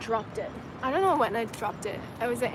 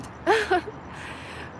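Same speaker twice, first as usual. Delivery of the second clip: high, breathy chuckle; amused giggle; 9 semitones higher than usual. Real, not acted. Being a mom to her viewers, breathy giggle. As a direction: laugh lightly at yourself.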